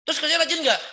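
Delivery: high, loud, needling question; mock challenging. Preacher pressing the believer about diligence, mock challenge. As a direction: press him harder, exaggeratedly.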